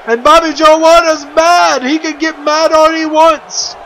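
high, loud, thrilled play-by-play yell; excited shout. Real, not acted. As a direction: shout the big moment to your co-host. Commentator sees a big elimination.

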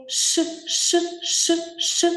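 «Ш» hissed unvoiced and repeated; hissed sh sound. Shown as a demonstration. Teacher hissing the sound for pupils. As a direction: hiss the «ш» like a snake. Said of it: "Наступний звук".

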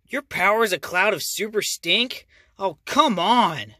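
loud exasperated disbelief; fed up, drawn-out complaint. Acted. Daniel exasperated at stink cloud.

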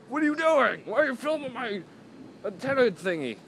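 hammed-up high-pitched objection; mock car owner. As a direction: pretend to be the upset car owner, voice way up. Acts out car owner objecting to filming.

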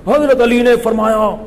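high, ear-splitting oratorical cry; forceful preacher's bellow. Retold or imitated. Speaker shows how the cleric's speech hurt his ears, forceful bellow. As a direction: blast it out like an overzealous cleric. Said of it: "میرے کان پھٹ رہے تھے نا ان کی تقریر".

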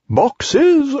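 high, loud innocent owl; feigned surprise. A narrator's reading. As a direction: act innocent, shocked. Owl voiced in story.

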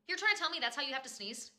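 normal talking voice

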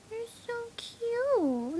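high, slow cooing. Real, not acted. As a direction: coo it sweetly. Cooing at puppy.

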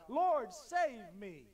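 loud, slow desperate plea; crying out. Acted. Sinking Peter cries to the Lord, loud and slow. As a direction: call out slowly for rescue. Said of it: "he cried out".

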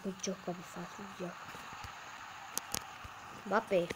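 usual speaking voice

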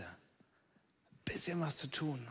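slow, soft whisper. Acted. Preacher whispers the wish to the congregation. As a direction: whisper it slowly. Dropped aside.

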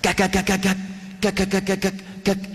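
rhythmic clipped gak bursts; imitated machine gun burst sounds; machine gun imitation. Shown as a demonstration. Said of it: "it sounds sort of like this".

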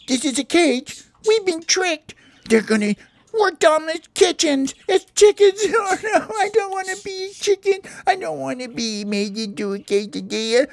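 high, wailing cartoon terror; hammed-up panic. Caged parakeet dreads becoming a quesadilla, high panicked wailing. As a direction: build to a piercing squeal, then wail. Trapped parakeets realise they'll be cooked.

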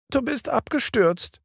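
put-on a little child close to tears; whimpering. A narrator's reading. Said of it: "wimmerte der kleine Junge".

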